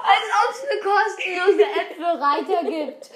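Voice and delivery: laughing, loud and slow; laughing disbelief. Real, not acted. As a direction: scoff through laughter. Spottet über die Podcast-Werbung. (lachend ungläubig)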